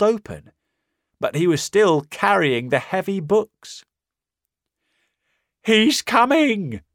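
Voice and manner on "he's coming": high, loud, slow terrified yell; panicked shout. Acted. Marvel bursting into the pub, shouting in terror. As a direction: cry the warning in terror. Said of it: "he shouted".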